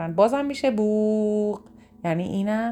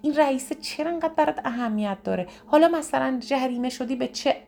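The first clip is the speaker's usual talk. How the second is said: higher, brushing-off scolding tone; dismissive friend; 6 semitones higher than usual. Retold or imitated. Friend brushes off the upset caller, dismissive scolding. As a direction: wave their upset away as no big deal.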